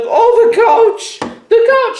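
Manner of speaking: giddy high-pitched stoner awe; stoned and blissed out. Acted. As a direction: marvel at everything like you're high. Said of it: "And he's like".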